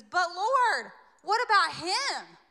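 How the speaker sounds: high, loud complaining plea; whiny Peter. Acted. Peter protesting to Jesus about John, high whiny plea. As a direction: Peter whining, pointing at someone else. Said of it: "Peter, seeing him, said to Jesus".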